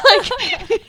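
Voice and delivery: bursts out laughing, loud and high; a big shrieking laugh at her own story. Real, not acted. Laughing out loud at the jail autograph joke.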